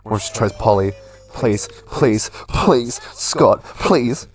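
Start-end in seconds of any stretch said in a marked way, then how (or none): none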